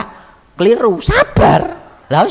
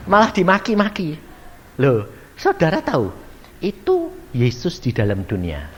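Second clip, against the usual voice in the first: ordinary talk, nothing marked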